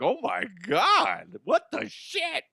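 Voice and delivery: slow then shooting way up, hammed-up shock; mock shock. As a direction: fake a scandalized gasp. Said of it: "then be like".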